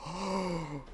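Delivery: long, hushed shocked gasp. Shown as a demonstration. Moviegoer demonstrating his reaction to the ending, gasping. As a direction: suck the air in, stunned. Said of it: "I have not gasped in a movie all year".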